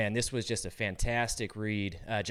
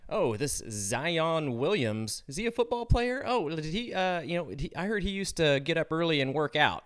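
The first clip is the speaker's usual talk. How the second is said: higher-pitched, transparently casual questioning; obvious feigned curiosity; 6 semitones higher than usual. Acted. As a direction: imitate a wife feigning innocent interest in sports. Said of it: "completely obvious that, you know, she's trying".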